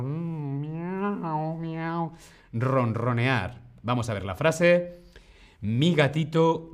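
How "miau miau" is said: soft, high meowing like a cat. Pretend. Teacher playing her contented cat, softly. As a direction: mew gently like a little cat.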